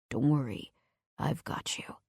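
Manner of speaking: low, soft reassurance fading to a hush; soothing. Acted. Man calms the frightened woman in the bathroom, soft and low. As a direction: soothe her like a balm. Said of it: "His voice is strong, deep, and soothing".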